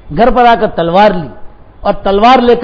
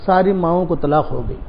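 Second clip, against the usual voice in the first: softer, lower hushed announcement; hushed bearer of bad news; grave news; 5 semitones lower, 8 dB quieter than usual. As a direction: break the terrible news gently. Breaking grave news softly.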